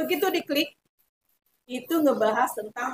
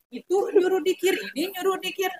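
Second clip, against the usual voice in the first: normal talking voice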